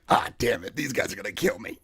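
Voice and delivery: low, hushed muttered aside; retold sinking realization. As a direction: mutter it under your breath in dismay. Dread dawns in the retold freezer.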